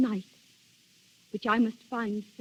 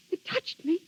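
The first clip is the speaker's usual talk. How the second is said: crying, rising up to a louder, higher pitch; young woman sobbing out her terror; fearful crying; 7 semitones higher, 4 dB louder than usual. Acted. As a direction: break down as the memory hits. Grazia reliving the ghostly touch.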